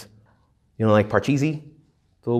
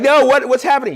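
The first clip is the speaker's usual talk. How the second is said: high, loud flustered panic; panicked confusion; 13 semitones higher, 6 dB louder than usual. Retold or imitated. Praying person, caught off guard, in flustered panic. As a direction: blurt it out, totally thrown. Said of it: "So you're like".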